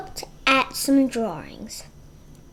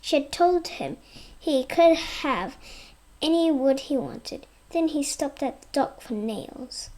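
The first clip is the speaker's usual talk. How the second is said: usual speaking voice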